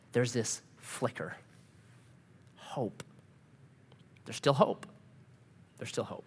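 soft, low and spaced out; quiet, reflective near-murmur; hushed hope. Real, not acted. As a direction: let it drop to a hush, with long pauses. Preacher dwells on faint hope.

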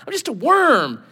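high mock wail, falling away; self-abasing. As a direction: whine it as pitiful Jacob. Jacob abasing himself.

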